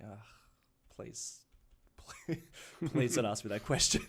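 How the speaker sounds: groaned plea breaking into laughter; mock pleading. Acts out dreading accent-guessing question.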